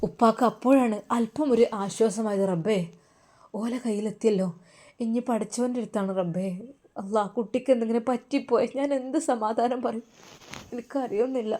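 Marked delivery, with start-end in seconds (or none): 2.5-11.5 hushed, helpless father; anxious prayer. Acted. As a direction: murmur a troubled prayer to God. Worried father pleading to God.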